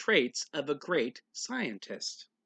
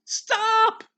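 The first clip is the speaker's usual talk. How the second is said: very high, loud alarmed shout; yelled stop; 9 dB louder than usual. Acted. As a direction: scream it to save the cat. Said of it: "her parents yelled".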